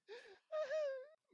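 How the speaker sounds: hammed-up weeping; loud high wailing sob. A narrator's reading. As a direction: burst into squeaky sobs. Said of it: "Myrtle gasped, tears now flooding".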